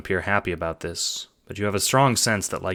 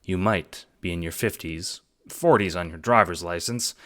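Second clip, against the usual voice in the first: ordinary delivery, nothing marked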